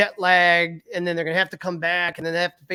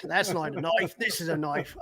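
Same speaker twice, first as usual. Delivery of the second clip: softer, lightly put-on Australian accent; mock Australian accent; 6 dB quieter than usual. Guest playing along with co-host's joke, mock Australian accent. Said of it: "always end up sounding Australian".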